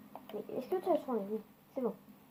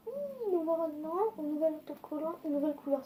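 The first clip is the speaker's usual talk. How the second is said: mock excitement; 5 semitones higher than usual. Real, not acted. Mock-excited about new bus paint.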